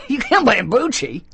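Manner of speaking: laughing high through the words. Real, not acted. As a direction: laugh as you say it. Laughing at himself.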